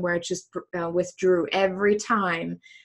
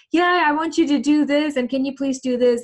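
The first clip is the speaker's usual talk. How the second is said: lightly voiced demanding people, a bit higher and louder; asking a favour, mildly pushy; other people asking; 7 semitones higher, 4 dB louder than usual. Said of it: "And then people like".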